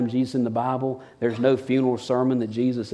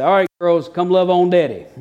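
put-on higher, louder morning call to the girls; playful daddy call; cheerful calling out; 6 semitones higher, 6 dB louder than usual. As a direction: call the kids for hugs. Dad calling daughters each morning.

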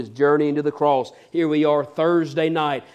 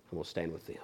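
much lower and softer, fading out; hushed repeat; 9 semitones lower, 16 dB quieter, much faster than usual. Acted. Judas repeats his choice to Jesus, hushed and low. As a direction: let it sink to almost nothing. Repeat falling to near silence.

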